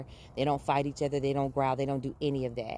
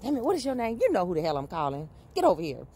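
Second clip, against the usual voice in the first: calling the dogs; 4 semitones higher, faster than usual. Retold or imitated. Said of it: "I've always done that with my dogs".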